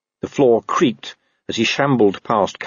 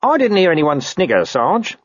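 high-pitched, innocent denial; squeaky denial to a boss; placating corporal; 9 semitones higher than usual. Acted. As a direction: play the nervous underling. Nervous Nobby placates suspicious boss.

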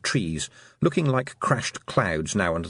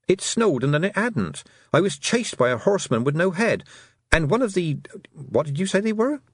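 high, breathless-sounding rush of complaint; girlish voice, pitched well up; flustered girl; 8 semitones higher than usual. A narrator's reading. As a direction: blurt it out, then break off puzzled. Tiffany blurts out her story.

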